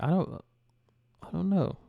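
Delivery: ordinary delivery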